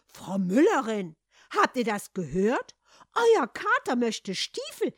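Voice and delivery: loud, drawn-out scandalised outcry; worked up. A narrator's reading. Farmer's wife tells the miller's wife, worked up. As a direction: shout it to the neighbour, climbing high. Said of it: "ereiferte sich die Bauersfrau".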